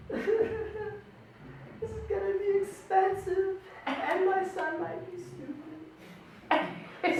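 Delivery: high, slow, laughing mock-sobbing mom voice; crying mom. Mom crying in the corner, high slow mock sob. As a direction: wail it like his weeping mother.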